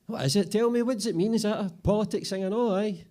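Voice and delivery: very high, loud pleading questions; desperate to be let in on it; clueless boss Bobby. Acted. Bobby begs the apprentices to explain, very high and loud.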